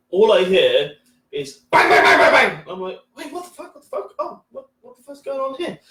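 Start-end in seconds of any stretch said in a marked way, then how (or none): 1.7-2.6 loud, rapid-fire banging noise; imitated banging. Comic retelling a sudden racket heard from the toilet, imitated banging. 3.2-5.8 high, very soft confused reaction; hushed bewilderment. Retold or imitated. Comic replaying his confusion in the toilet, hushed bewilderment.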